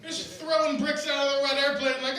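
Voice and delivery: usual speaking voice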